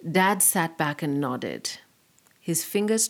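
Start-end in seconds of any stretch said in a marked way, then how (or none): none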